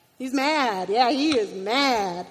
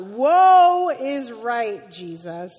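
exclaimed thought; 9 dB louder, much slower than usual. Retold or imitated. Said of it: "I thought".

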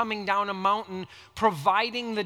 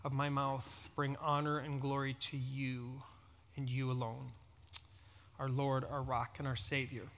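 low, soft, level praying voice; hushed prayer with pauses between phrases; closing prayer; 8 semitones lower, 12 dB quieter than usual. Real, not acted. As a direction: pray quietly and reverently, phrase by phrase. Closing prayer before the sermon.

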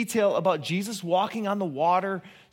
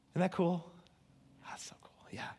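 soft whisper of wonder; whispered awe; 12 dB quieter than usual. Real, not acted. Quiet awe aside.

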